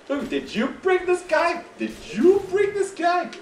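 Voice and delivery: very high, squeaky incredulous questioning; mock accusing. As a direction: accuse them in a silly high voice. Mock accusation over tagalong character.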